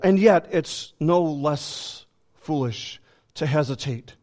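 usual speaking voice

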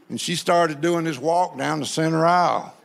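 usual speaking voice

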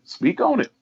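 a soft, very high call that climbs upward; hype urge, rising. Real, not acted. Cheering the guest on.

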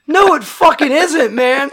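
high, loud angry rebuttal; retold heated shout; exasperated yell. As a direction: shoot him down loudly, pitch up. Yelling back at the grave digger.

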